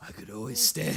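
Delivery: low deadpan grizzled line; a deep grizzled old-timer. Acted. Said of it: "In a very deep, grizzled voice".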